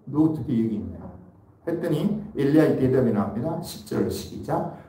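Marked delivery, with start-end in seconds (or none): 0.0-1.0 soft, low reproach, quieter and lower than his preaching; gentle rebuke. Shown as a demonstration. God gently rebuking Elijah, soft and low. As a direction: scold him tenderly, barely raising it. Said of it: "책망인데 부드럽게 한 모습이죠".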